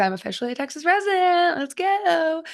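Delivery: high put-on Texas accent. New Texas resident celebrating on the podcast, Texas accent. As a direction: lift it into a hammy Texas twang. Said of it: "my Texas accent".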